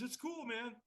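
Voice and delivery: hushed, easygoing voice; placating. Retold or imitated. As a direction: soothe him quietly. Stranger soothing the refuser.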